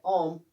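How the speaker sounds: ओम with no R before the M; silent R. Shown as a demonstration. Teacher repeats the correction, R silent in ओम. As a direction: say ओम, dropping the R. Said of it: "और इसमें silent रहता है".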